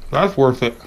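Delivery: usual speaking voice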